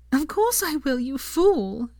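high, elated exclamation; joyful cry. A narrator's reading. Hermione accepting Draco's proposal, joyful cry. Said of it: "she cried, laughing still".

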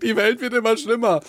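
high mock old-lady whine; grandma voice. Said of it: "wenn meine Oma sagt".

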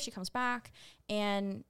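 usual speaking voice

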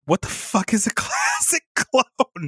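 high, slow mock-baffled question; incredulous, stretched out through laughter; laughing bafflement. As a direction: crack up halfway through the question. Retold baffled reply, cracking up.

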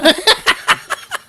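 high squeaky giggle; high laugh. Real, not acted. Laughs after the imitation. (risa aguda)

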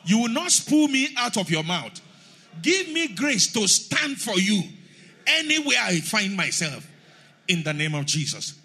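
very loud fervent prayer; shouted prayer. Real, not acted. Pastor leading the church in shouted prayer, very loud. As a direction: shout it with all your strength. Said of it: "Somebody shout".

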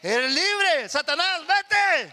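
loud command to Satan; commanding shout. Acted. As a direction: cast the devil out with authority. Jesus casting out Satan.

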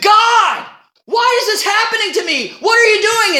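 retold scream at God. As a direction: yell up at God in frustration. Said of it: "in that cooler screamed".

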